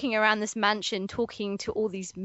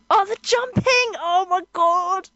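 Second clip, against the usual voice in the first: excited; 7 semitones higher, 4 dB louder than usual. Real, not acted. Gushing over the video.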